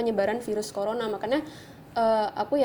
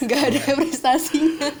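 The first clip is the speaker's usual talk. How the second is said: mock crying; 4 semitones higher, 8 dB louder than usual. Modest joke about no achievements.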